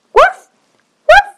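high, loud little puppy yips; high puppy bark. Acted. Puppy barks in story.

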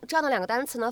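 ordinary talk

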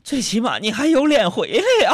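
high, loud burst of mother's outrage; mock scolding. Mother to son home broke, hammed-up indignant jab. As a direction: let fake comfort explode into scorn.

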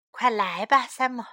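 ordinary delivery, nothing marked

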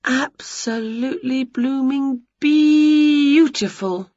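high, slow, drawn-out wonder; long, dreamy exclamation; sighing admiration. A narrator's reading. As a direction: sigh it in rapture. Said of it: "sighed Winnie".

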